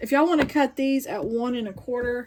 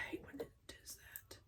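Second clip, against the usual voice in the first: annoyed whisper; 26 dB quieter than usual. Real, not acted. Annoyed at the sewing machine.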